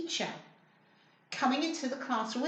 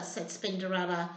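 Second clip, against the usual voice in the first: ordinary delivery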